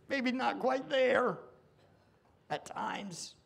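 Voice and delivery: loud, high, impassioned confession; full-force preaching. Real, not acted. Preacher confessing his own heart to the congregation. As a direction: cry it out to the room.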